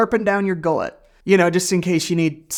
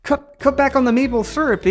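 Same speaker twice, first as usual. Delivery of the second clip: high, halting fake Canadian accent; attempted Canadian accent; 6 semitones higher than usual. Vlogger retrying the maple syrup line, failed Canadian accent. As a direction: stumble through a bad Canadian impression. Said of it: "I don't even know what a Canadian accent sounds like".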